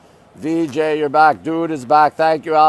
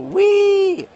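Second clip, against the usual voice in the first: gleeful squeal. Pretend. Excited sledding squeal.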